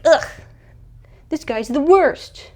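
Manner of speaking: mock disgust. [name] groaning about her dance date, mock disgusted. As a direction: act out a disgusted teen, stretching the words.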